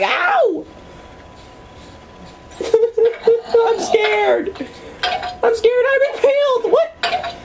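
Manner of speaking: very high laughing panic; high-pitched laughing fright; panicked squeals. Real, not acted. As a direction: squeal in mock terror while laughing. Hit by arrows, panicking.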